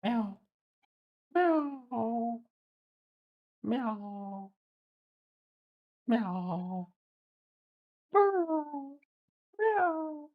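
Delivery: high soft imitated cat meows. A man meowing softly and high-pitched to his cat. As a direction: meow back at the cat in a tiny high voice.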